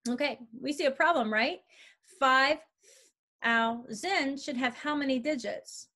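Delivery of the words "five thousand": "Five thousand" in separate beats, louder "Five"; syllables emphasized. Shown as a demonstration. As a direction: pause after "Five", then split thou, sand. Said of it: "now emphasize the syllables".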